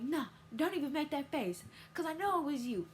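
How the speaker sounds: imitating an accusing mother; mom scolding. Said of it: "my mama was like".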